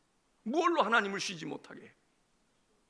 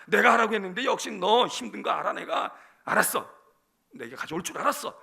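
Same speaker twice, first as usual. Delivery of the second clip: loud, understanding rebuke; God's emphatic reply; 9 dB louder than usual. Acted. God answering struggling church members, emphatic and loud. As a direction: answer forcefully as God, half scolding.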